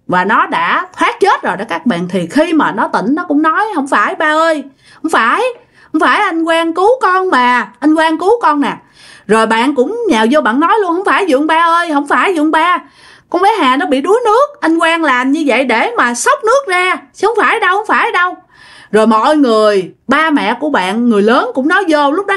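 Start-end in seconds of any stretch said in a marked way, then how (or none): 3.7-8.8 higher-pitched, insistent protest; acted plea. Girl telling her dad that Quang saved her, high insistent plea. Girl pleads with angry father for rescuer. 11.0-18.4 high, urgent denial tumbling out; acted insistent protest. Friend telling the uncle it was a rescue, high urgent protest. Friend jumps in to defend the accused.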